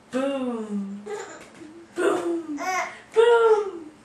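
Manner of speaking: big loud booms; loud booming. Acted. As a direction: boom it out loudly. Booming sound review.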